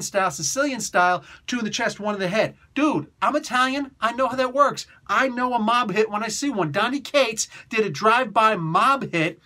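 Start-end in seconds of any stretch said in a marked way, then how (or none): none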